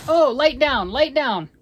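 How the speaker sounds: loud called command; calling out. Real, not acted. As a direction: call it across the room. Calling out to someone.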